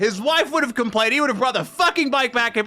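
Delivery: real worked-up rant, pitched high; exasperated rant. Real, not acted. Bike mechanic ranting about what the customer would have done, exasperated.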